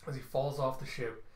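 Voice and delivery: ordinary delivery, nothing marked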